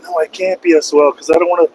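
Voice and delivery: lightly voiced exasperated self; retold panic. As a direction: replay his own frantic protest. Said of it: "I'm like".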